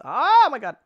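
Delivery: sudden loud, high exclamation; startled outburst. Real, not acted. Player interrupted mid-plan by a shock, startled cry. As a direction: burst out in surprise.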